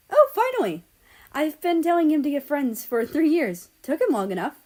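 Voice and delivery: high, loud exclamation of relief; relieved excitement. A narrator's reading. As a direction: cheer that it finally happened. Ukraine's relieved happy outburst.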